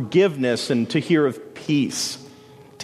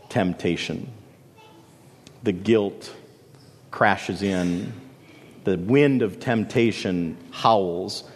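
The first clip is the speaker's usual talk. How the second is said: hushed sermon build; 5 semitones lower, 3 dB quieter than usual. Acted. Dramatic sermon imagery.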